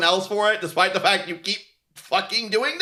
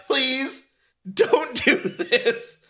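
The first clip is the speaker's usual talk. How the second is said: slow, higher drawn-out plea; exaggerated slow entreaty; mock pleading; 4 semitones higher, much slower than usual. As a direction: beg slowly with mock desperation. Imitates people begging studio to stop.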